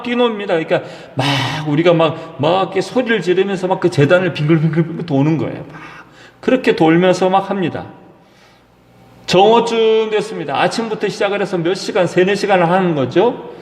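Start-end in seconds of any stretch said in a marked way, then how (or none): none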